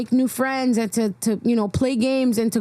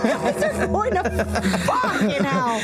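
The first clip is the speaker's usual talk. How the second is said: laughing; 8 semitones higher than usual. Real, not acted. Laughing at the joke.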